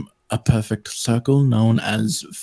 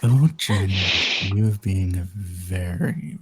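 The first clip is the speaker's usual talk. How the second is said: sexy voice; 4 semitones lower than usual. Pretend. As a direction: tease her in a husky hush. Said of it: "Oh my God".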